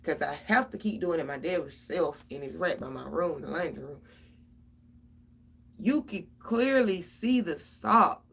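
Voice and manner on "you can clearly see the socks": slow, loud, word by word; slow emphasis. Real, not acted. A mother ranting that the socks are plain to see, slow and loud. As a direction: hammer it home slowly.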